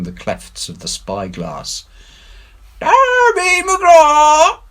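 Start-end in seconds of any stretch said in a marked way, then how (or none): 2.8-4.6 very high, slow, loud distant wail; eerie far-off wail; ghostly wail. A narrator's reading. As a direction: wail it like a faint far hail. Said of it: "it wailed".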